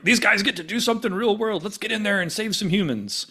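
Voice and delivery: high-pitched imitation of eager officers; hammed-up eagerness, pitched well up; mock gung-ho commanders. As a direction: imitate overeager bosses rallying the team.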